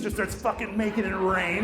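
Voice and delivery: ordinary delivery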